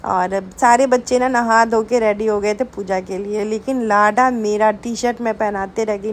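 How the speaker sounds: normal talking voice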